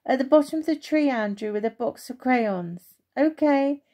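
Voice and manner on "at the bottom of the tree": higher, louder, quick called reply; mother answering from the house; calling back. Acted. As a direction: call it back across the garden. Mum calls back to Andrew.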